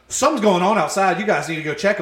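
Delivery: urgent alert. Retold or imitated. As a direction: imitate someone bursting in with news. Retold men running in with alarm.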